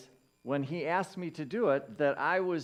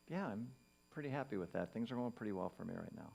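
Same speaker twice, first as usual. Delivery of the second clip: quiet self-reply; 4 semitones lower, 10 dB quieter, faster than usual. Acted. Answers own inner question softly.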